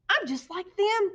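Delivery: proud discovery, drawn out; excited little bat. A narrator's reading. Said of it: "thought Stellaluna".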